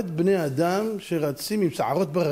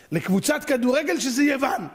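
raised, high-pitched indignation; appalled, voice pitched up; indignant outcry; 8 semitones higher than usual. Real, not acted. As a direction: cry out in disbelief. Indignant outcry over team name.

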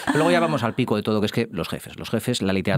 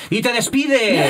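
high, slow, dramatic reveal; dramatic exclamation; 11 semitones higher, 5 dB louder, much slower than usual. Acted. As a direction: announce it with a big theatrical flourish. Dramatic plot twist.

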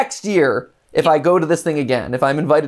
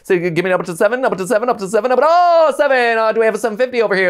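higher, fast auction patter; auctioneer; 5 semitones higher than usual. Shown as a demonstration. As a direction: rattle off bids like an auctioneer. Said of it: "Can you imitate an auctioneer?".

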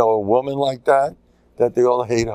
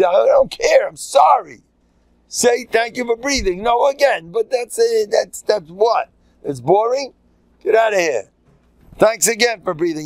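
high-pitched, exasperated insistence; insistent scolding; 8 semitones higher than usual. Acted. Rabbi pressing a listener to say thanks for breathing, insistent scolding. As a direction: badger them, pitched well up.

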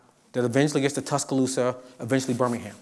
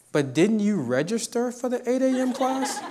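teasing laugh; 9 semitones higher, 3 dB quieter than usual. Retold or imitated. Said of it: "To which I say".

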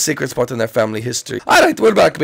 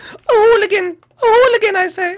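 Mario exclaiming; 4 dB louder than usual. Acted.

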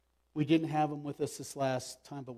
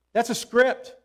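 loud, raised-pitch sermon delivery; forceful preaching; 4 semitones higher, 8 dB louder than usual. Real, not acted. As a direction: hammer the message home to the congregation. Preaches the passage at full force.

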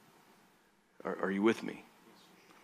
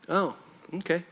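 usual speaking voice

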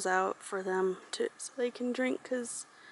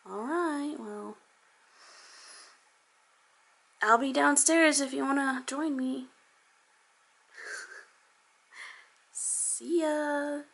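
higher, coaxing voice to a cat; sweet pet talk; 5 semitones higher, 4 dB quieter than usual. Real, not acted. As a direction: coax the cat gently upstairs. Talking to her cat Mikey.

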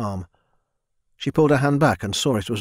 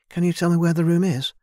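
put-on higher, timid young woman's voice; young servant girl; 8 semitones higher than usual. A narrator's reading. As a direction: voice a shy girl speaking up to her lord. Narrator voices Mina.

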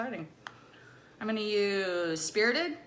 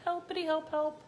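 playful sing-song; 6 semitones higher than usual. Acted. Playful made-up word.

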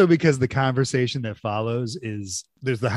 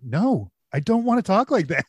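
higher, amused imitation of a refusal; Gene Wilder; 7 semitones higher than usual. Imitating the actor refusing to talk like that, amused and higher. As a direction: refuse it like the straight man, half laughing. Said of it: "Gene Wilder's like".

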